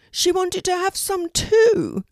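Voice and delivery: very high, slow raven voice; explaining raven. Acted. Melina the raven explains about her friend Poppy, high slow voice. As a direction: draw it out high and loud.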